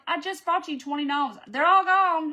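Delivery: rising to a loud, high outburst on all gone; exasperated mock exclamation; retold disbelief. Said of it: "I'm like".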